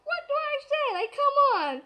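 high, loud mock panic; flustered exclamation. Nervous fan stuck for an interview answer, high flustered outburst. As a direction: blurt it out, exasperated.

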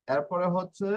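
ordinary delivery

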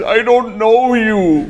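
a high, slow, frightened squeak, hammed up; panicked denial. Scared stranger denying to the homie, panicked squeak. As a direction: clutch your chest and squeal it slowly. All paniqueado.

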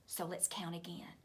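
put-on whisper to children. A narrator's reading.

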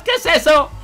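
high, loud panicked cry; alarmed shout. Acted. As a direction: shout a frightened warning. Alarm as the army arrives.

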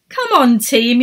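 eager call to the team; yelling. A narrator's reading. Errol yelling to his team, eager shout. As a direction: shout it up the ice. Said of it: "yelled Errol".

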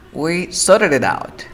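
slow model sentence with "sor-ed"; "sorted" without T, slowly linked. Shown as a demonstration. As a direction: drop the T in "sorted". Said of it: "aquí estoy pronunciando R".

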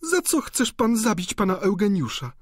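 put-on high-pitched, distressed young lady; pleading cry. A narrator's reading. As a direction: implore him in a very high voice. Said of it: "zawołała Wiktoryna, składając ręce". (błagalne pytanie wysokim głosem)

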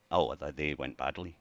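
ordinary talk, nothing marked

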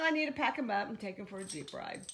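ordinary talk

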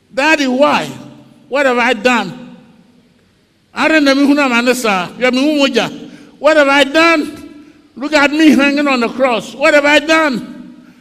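loud high anguished pleading; pleading cry. Acted. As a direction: beg a father to explain. Joseph pleading, sold as slave.

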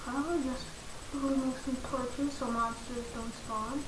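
soft unvoiced whisper. Real, not acted. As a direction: whisper the plan so nothing hears. Whispering while mining.

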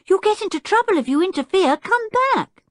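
high, urgent warning shout; anxious high-pitched calling. A narrator's reading. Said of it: "shouted Anne".

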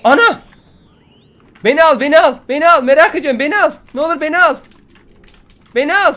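very high, loud screaming begging; screaming plea. Real, not acted. Gamer begging the spaceship to take him, screaming. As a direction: scream at the sky to be taken.

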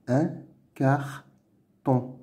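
"Un carton" as "un", "car", "ton"; syllable by syllable. Shown as a demonstration. Teacher sounding out words to read, "Un carton" in separate syllables. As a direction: pause after each syllable of "Un carton". Reading drill, split then blended.

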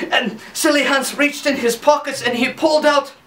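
usual speaking voice

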